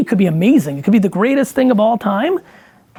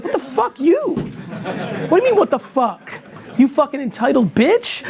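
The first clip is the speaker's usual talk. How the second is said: higher, half-laughing mock indignation; mock outraged retort; 5 semitones higher than usual. Answering angry fans, a laughing mock-outraged comeback. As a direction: fire it back, laughing in disbelief.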